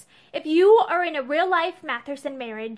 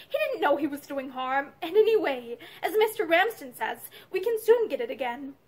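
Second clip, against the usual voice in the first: plain reading voice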